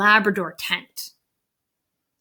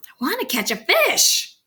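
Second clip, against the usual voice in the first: put-on high, impatient child's plea; impatient kid whine; 9 semitones higher than usual. A narrator's reading. Fidgety girl complaining to her dad while fishing, impatient whine.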